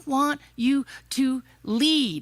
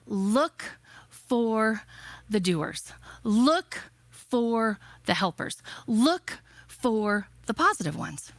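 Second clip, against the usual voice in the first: spaced out, word by word with pauses; slow, measured advice; slower than usual. Retold or imitated. Mother to her scared son, slow and deliberate. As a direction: deliver it slowly, letting each phrase land.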